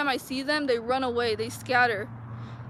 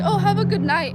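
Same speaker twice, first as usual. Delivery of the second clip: mocking imitation; 7 semitones higher, 7 dB louder than usual. Quoting neighbor's sly goodnight again.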